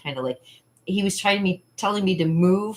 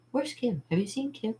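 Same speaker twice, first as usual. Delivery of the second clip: higher and softer quoted questions; lightly voiced searchers, pitched up; searching family voice; 5 semitones higher, 7 dB quieter than usual. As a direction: imitate puzzled relatives looking for a child. Family searching for hidden child.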